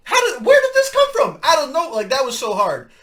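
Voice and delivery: high, loud incredulous shouting; stunned disbelief. Real, not acted. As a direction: yell it like you can't believe what you heard. Stunned reaction to a soft diss.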